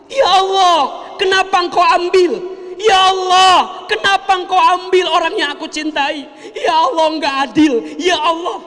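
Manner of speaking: angry wail. Acted. Grieving mourner shouting at God in anguish.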